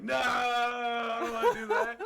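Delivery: high, loud, slow laughing retreat; laughing mock whine. Sobered-up self backing out, laughing and high. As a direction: back out while laughing. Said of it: "once I, like, sober up".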